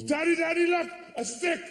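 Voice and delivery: put-on very high, eager little kid voice; high mock child voice; excited child. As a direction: squeal it like a thrilled toddler. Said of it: "the child goes".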